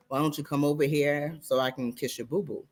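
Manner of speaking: mock-tender offer to kiss her boo-boo; teasing tender. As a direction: soothe her like a child.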